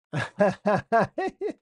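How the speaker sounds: a real cackle at the chat; sudden high laugh. Real, not acted. Streamer cracks up at a viewer's comment, high laugh.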